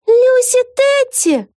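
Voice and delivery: very high, loud, drawn-out cry; yelling. A narrator's reading. Vega recognises a familiar huge face, yelling. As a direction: wail the name out at a high pitch. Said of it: "завопила Вега".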